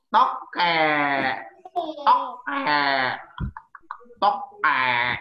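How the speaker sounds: loud tokek calls; gecko call. Shown as a demonstration. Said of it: "bunyi tokek kayak gitu".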